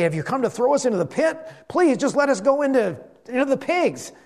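high, quick mock begging; afraid demons pleading. Demons begging to go into the pigs, pleading. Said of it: "They're afraid he's gonna cast them".

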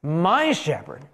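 slow, emphatic declaration; measured outburst of praise; proclaimed outburst. Acted. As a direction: proclaim it slowly, drawing out each key word. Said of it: "he starts with an outburst".

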